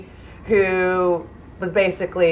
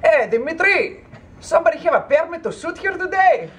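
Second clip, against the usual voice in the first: walkie-talkie voice; 9 semitones higher than usual. Retold or imitated. Guard radioing Dimitri about a shooting permit, walkie-talkie voice. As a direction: imitate a guard calling over a walkie-talkie. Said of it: "like, walkie-talkie".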